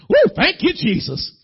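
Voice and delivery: high, loud whoop of thanks; exuberant praise shout. Acted. Driver praising Jesus at the traffic light, exuberant shout.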